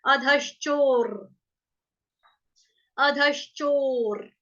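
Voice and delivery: अधश्चोर slow, with the र placed after शो; slow demo. Shown as a demonstration. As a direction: say अधश्चोर slowly, the र at the end. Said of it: "उसका उच्चारण हम इसी तरह से करते हैं".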